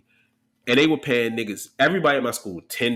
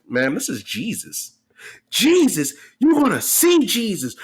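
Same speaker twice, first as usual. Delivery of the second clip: a hammed-up booming Jesus, higher and louder; prank call voice; 7 semitones higher, 3 dB louder than usual. Teen prankster telling the angry lady he is Jesus, mock booming. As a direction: announce yourself as Jesus to scare her. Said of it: "I'm like".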